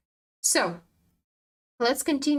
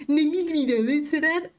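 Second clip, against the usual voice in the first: indignant outrage; 6 semitones higher, 4 dB louder than usual. Real, not acted. Outraged at small profit.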